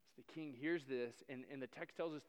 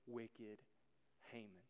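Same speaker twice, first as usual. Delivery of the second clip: much softer and lower, each word apart; hushed accusation; 5 semitones lower, 13 dB quieter, slower than usual. Acted. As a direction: drop the voice to name the villain. Queen accuses Haman.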